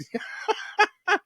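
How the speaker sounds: shrill cackle of laughter; high squealing laugh. Real, not acted.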